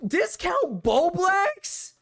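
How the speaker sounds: high, slow, drawn-out disbelief; incredulous shriek. Real, not acted. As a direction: stretch the name out in shocked disbelief. Incredulous at chat's nickname.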